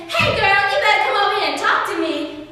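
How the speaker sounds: put-on catcalling boy. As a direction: shout it at a girl walking past, brash and fast. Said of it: "boy jumped out at me saying".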